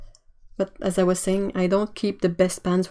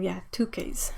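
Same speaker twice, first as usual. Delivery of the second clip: whispering; 2 semitones lower, 8 dB quieter than usual. Real, not acted. Whispered aside.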